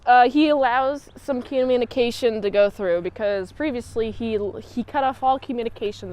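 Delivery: usual speaking voice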